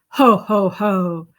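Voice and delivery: loud, low mocking ho ho; menacing giant laugh; ugly laugh. A narrator's reading. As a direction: gloat with a nasty laugh. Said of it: "laughed an ugly laugh".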